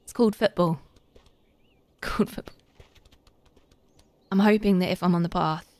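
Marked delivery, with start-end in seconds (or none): 1.3-2.5 breathy laughing whisper, mock correction. Repeating the chat's correction in a laughing whisper.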